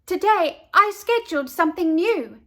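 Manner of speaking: high, loud proud announcement; announcing. A narrator's reading. Girl tells her nanny about the new plan, loud and high. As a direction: proclaim it like big news. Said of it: "Ernestine announced".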